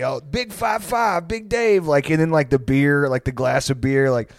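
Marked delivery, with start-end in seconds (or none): 0.0-1.8 high, drawn-out hearty greeting; mock well-wisher. Buddy congratulating Dave online, slow mock-hearty high voice. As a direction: imitate a buddy hailing an old pal.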